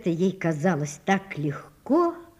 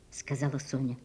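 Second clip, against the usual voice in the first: ordinary delivery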